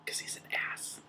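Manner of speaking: dry joke in a whisper; whispered aside. Acted. Morwen whispering her joke about the wizard. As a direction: breathe the punchline under your breath.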